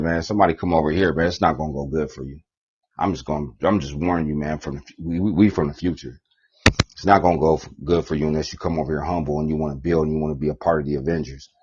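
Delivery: quiet, fast low warning; hushed, hurried threat; ominous warning. Acted. Warning people from the future, quiet ominous threat.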